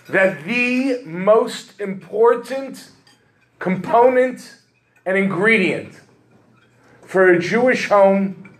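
slow, high, declamatory sermon delivery; preaching emphasis. Acted. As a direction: lift each phrase and lean on it from the pulpit. Preacher's emphatic core message.

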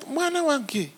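put-on calm your child down gently; soft fatherly hush in Luganda; soothing God. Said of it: "And he's like".

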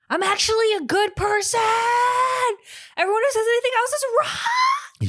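high-pitched mock scream. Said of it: "I scream like".

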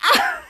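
short, high "ah"; mock yelp. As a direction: yelp as if struck, in play. Hit by guillotine door.

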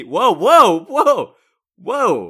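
loud, high-pitched whoas; alarmed objection. Acted. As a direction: jump in to halt him. Host cuts off a slur.

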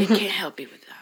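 unvoiced low whisper. Real, not acted. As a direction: breathe it, no voice at all. Aside, whispered. (sussurro baixo sem voz)

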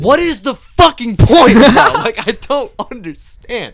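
high, loud, disbelieving laugh-shout; laughing rant. Real, not acted. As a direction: cackle in disbelief at it. Ranting about looping store playlist.

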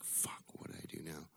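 panicked whisper to himself. Retold or imitated. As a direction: hiss it under your breath, scared. Called to the office, panicking.

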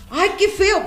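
a touch of high, blunt mom; lightly voiced blunt mom; imitating mom. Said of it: "y mamá".

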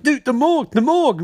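high, hurried urgency in a retold quote; urgent. Cisco alerts Barry about the morgue, acted urgent.

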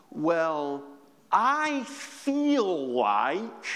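drawn-out, mocking "I feel like"; mock dismissive quote. Preacher mocking a shallow excuse to congregation, drawn out. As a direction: imitate a shrugging believer. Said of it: "The most dangerous thing that we can say is this sentence".

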